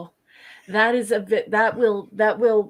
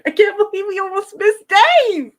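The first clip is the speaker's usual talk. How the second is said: much higher and louder, in a put-on character voice; 11 semitones higher, 9 dB louder than usual. Cohost squealing relief at a nearly missed name, high and loud.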